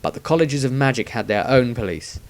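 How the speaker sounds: ordinary delivery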